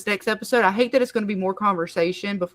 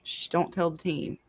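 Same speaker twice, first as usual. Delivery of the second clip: hushed, conspiratorial aside; mock hush; 3 semitones lower, 8 dB quieter than usual. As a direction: keep it secret from the team, softly. Joking secret to the group.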